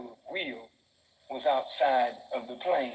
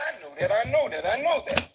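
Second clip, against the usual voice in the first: each repeat higher and louder; building insistence; 8 semitones higher, 4 dB louder, faster than usual. Acted. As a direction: climb with every repeat. God's reply, repeated rising.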